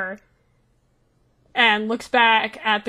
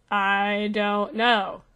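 very slow, drawn-out, unsure; hesitant; much slower than usual. Acted. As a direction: stretch it out after a long pause. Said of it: "he hesitates for a long moment".

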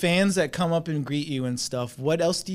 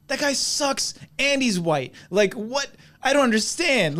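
indignant; 9 semitones higher, 4 dB louder than usual. Retold or imitated. Retold indignant reaction.

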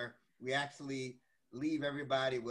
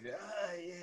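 fake sick voice; 7 semitones higher than usual. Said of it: "Gotta use the sick voice".

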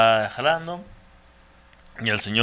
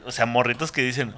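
ordinary talk, nothing marked

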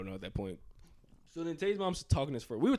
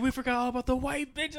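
excited; 11 semitones higher, 6 dB louder than usual. Retold or imitated. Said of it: "He said".

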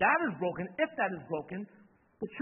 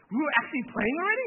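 surprised; 9 semitones higher, 8 dB louder, faster than usual. Retold or imitated. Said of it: "retold disbelieving reply in game".

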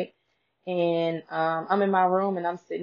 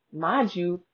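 usual speaking voice

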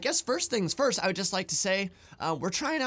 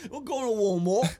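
pitched-up copy of mock British "Walmart"; British accent; 3 semitones higher, 3 dB louder than usual. Shown as a demonstration. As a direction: echo the British accent through a laugh. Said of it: "That's how they do it".

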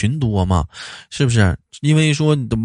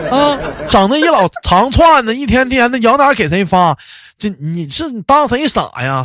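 exasperated rant; 8 semitones higher than usual. Real, not acted. Ranting about mass greetings.

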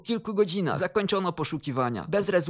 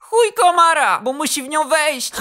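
very high, loud tipsy punchline; loud cackling; 10 semitones higher, 9 dB louder than usual. A narrator's reading. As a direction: blurt the punchline and roar with laughter. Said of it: "zarechotał głośno". (głośny, wysoki rechot)

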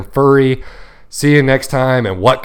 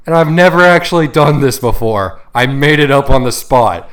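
raised, higher-pitched shout in retelling; quick yell; 4 semitones higher, 6 dB louder than usual. Retold or imitated. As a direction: call it out as if mid-fall. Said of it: "I quickly yell at him, also saying".

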